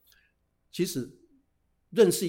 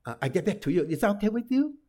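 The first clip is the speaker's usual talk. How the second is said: normal talking voice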